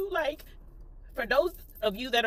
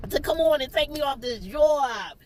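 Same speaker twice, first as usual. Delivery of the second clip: high, tearful plea breaking into laughter; real crying; 7 semitones higher than usual. Real, not acted. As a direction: wail it through laughing tears. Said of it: "getting on here crying".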